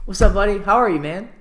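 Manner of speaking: loud, high, excited hello to a buddy; best-friend greeting. Shown as a demonstration. Greeting a best friend, loud and excited. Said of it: "or with the best friend".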